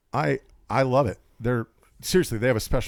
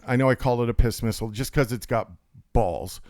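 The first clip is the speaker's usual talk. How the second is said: ordinary talk, nothing marked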